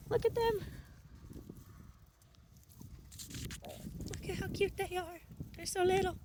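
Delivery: high, soft cooing voice; cooing over piglets. Real, not acted. Farmer cooing softly at her newborn piglets, high and hushed. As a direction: coo at the tiny newborns like a doting owner.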